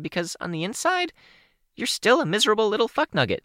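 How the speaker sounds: put-on high girl voice turning stern; a high young girl laying into him; high teen girl voice, hurt and scolding; Kenzie, scolding.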